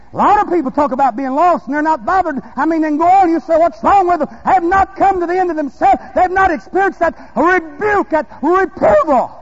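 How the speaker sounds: loud, high, fervent preaching cry; full-force preaching. Real, not acted. Preacher urging the congregation to draw from their own well, full-force preaching.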